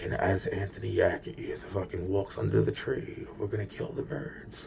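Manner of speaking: hint of a sinister plotter; plotting conspirator voice. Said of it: "he's like".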